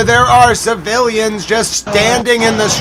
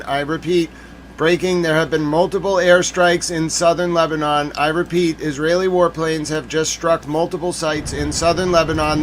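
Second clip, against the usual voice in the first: low, soft urgent news anchor, a bit hurried; mock breaking news; 4 semitones lower, 6 dB quieter than usual.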